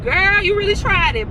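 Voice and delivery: put-on high, sassy "girl, you tried it"; retelling a snub in a high, sassy voice; sassy disbelief. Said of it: "So I'm just like".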